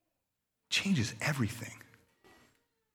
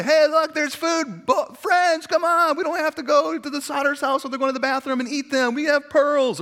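high, loud squealing pig calling friends; excited pig; 13 semitones higher, 7 dB louder than usual. Pretend. Pig to its friends, squealing excitedly about food. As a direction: ham up a greedy pig shouting to its pals. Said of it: "pig's gonna say".

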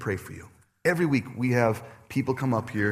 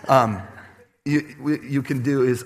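ordinary talk

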